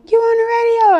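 high, loud, amazed question; excited caller. Retold or imitated. Friends calling the rapper, excited and loud. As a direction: squeal it like a thrilled friend. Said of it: "they calling me like".

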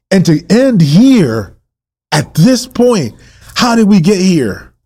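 high, swooping sermon cries; impassioned preaching. Real, not acted. Preacher challenging the family over Israel's fall, impassioned. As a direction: preach it with rising disbelief.

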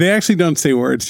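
normal talking voice

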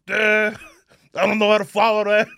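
panicky father-in-law. Retold or imitated. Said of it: "my wife's dad goes".

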